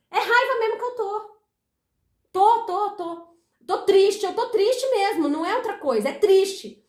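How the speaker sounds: high-pitched, emphatic acted admission; firmly acted declaration, punching each repeat; insistent owning-up. Acting out owning an emotion.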